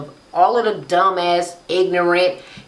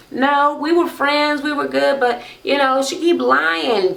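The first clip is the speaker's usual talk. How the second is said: mock complaining; 8 semitones higher than usual. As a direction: act out an aggrieved answer. Acting out cast member answering host.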